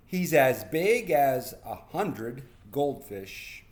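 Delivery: slow, drawn-out boast. Acted. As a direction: stretch it out like a proud kid. Boasting about dad.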